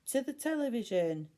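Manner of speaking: high, loud rallying cry; cried out together. A narrator's reading. Kids rallying each other to the television. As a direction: charge off with a shout. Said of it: "they all cried".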